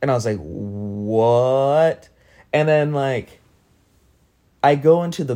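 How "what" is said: long drawn-out "what" rising into a question; stunned disbelief. Retold or imitated. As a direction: stretch it out, incredulous. Stunned reply in retold dream.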